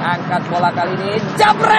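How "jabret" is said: loud, high jebret call; jebret shout. Real, not acted. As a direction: bark out the goal call. Signature goal call.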